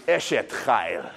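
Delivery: Eshet chayil said slowly with a guttural ch; Hebrew phrase. Shown as a demonstration. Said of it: "they say to you".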